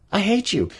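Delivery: put-on high, whiny teenage snap; hammed-up adolescent scorn; mock sulky teen. Fourteen-year-old snaps at a parent, high and whiny. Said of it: "a fourteen-year-old would be like".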